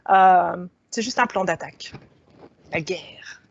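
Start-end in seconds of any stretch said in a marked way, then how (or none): 2.7-3.4 whispered, half joking; a playful dramatic whisper; mock whisper. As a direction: hush it like a war secret. Dramatic aside on battle plan.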